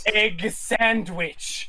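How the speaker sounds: very slow, drawn out; slow emphasis. Acted. Friend savoring the egg sandwich, slow emphasis. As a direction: stretch each word with awe.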